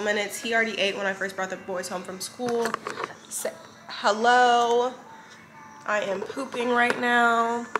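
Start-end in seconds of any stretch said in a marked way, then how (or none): 3.3-7.7 put-on slow, high voice for the baby. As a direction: speak for the baby, slowly. Mom voicing the baby.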